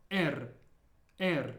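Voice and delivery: "R" with a rolled Russian r, unlike English. Shown as a demonstration. Teacher modelling the last letter for learners, rolled R. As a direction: roll the tongue on "R", as in Spanish. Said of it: "because it's rolled".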